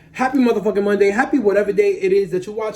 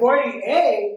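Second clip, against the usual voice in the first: shocked; 3 semitones higher than usual. Real, not acted. Shocked at the wig length.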